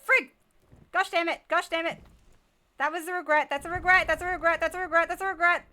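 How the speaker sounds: loud, high-pitched panicked outburst; spooked yelling. Real, not acted. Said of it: "I get spooked".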